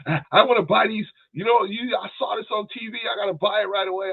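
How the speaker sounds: imitating wife. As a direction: play the wife begging to buy it now. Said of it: "My wife will come to me".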